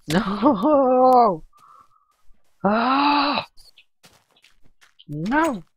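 loud, high, frantic shouts at the misbehaving mouse; real panic, yelling as the mouse goes haywire; panicked yelling. Real, not acted. Mouse glitching mid-game.

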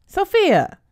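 sharp, loud cry of her name; a loud, scandalized gasp of a name; shocked scolding. Acted. Sister asks about kissing.

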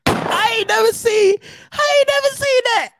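high, stunned cry of disbelief; can't believe what he's seeing. Real, not acted. Shocked by the photo.

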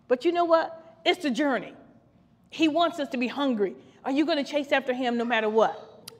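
ordinary delivery

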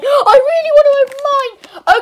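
excited pleading. Acted. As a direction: beg harder the second time. Patrick repeats wish, second louder.

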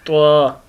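त्वा spoken heavy, tongue tip at the root of the upper teeth; Arabic ط pronounced slowly; heavy letter demo. Shown as a demonstration. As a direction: model त्वा clearly for pupils. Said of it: "तो ये लफ्ज़ अदा होता है त्वा".

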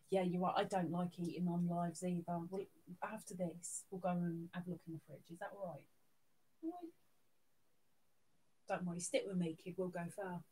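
low, very soft murmur; reassuring aside. Real, not acted. Streamer promises hungry pet a snack, soft reassurance. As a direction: talk softly and low, just to the pet.

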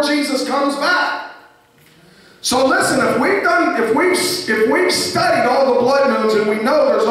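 high-pitched, insistent sermon voice; sustained high preacher's delivery; urgent preaching. Real, not acted. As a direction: press the warning home to the congregation. Preaches on signs before Jesus returns.

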